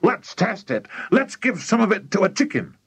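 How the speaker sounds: high, eager exclaiming; mildly shouted, bursting to try it; excited shout. A narrator's reading. Mister Cranky proposes testing on a chicken, mild excited shout. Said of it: "shouted Mister Cranky".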